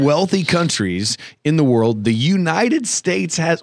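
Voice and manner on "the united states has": slow and high on "the United States"; pompous, drawn-out "United States"; odd emphatic voice. Acted. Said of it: "why did I say it like that?".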